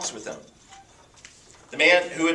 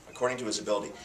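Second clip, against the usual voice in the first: quiet echo; 4 semitones lower, 7 dB quieter, much faster than usual. Shown as a demonstration. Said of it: "underline it".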